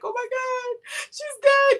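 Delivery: high, loud panicked wail; mock freaking out. Imitating the partner who found the dog not moving, mock freak-out. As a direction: imitate someone in hysterics over a pet. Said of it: "Babe was freaking out".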